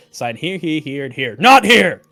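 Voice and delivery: rising to a yelled not here; building to a shout. Retold or imitated. Acting Cat in the Hat contract.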